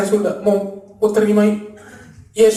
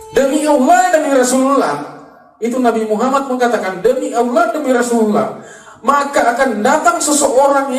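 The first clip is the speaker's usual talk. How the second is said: raised, ringing declaration in a higher pitch; emphatic preaching; 5 semitones higher than usual. Real, not acted. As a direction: proclaim it to the congregation, pitch lifted. Preaches the quoted oath emphatically. (berkhotbah lantang dengan nada tinggi)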